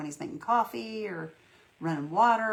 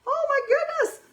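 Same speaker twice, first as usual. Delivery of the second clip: excited; 8 dB louder than usual. Real, not acted. Excited gasp at viewer comments.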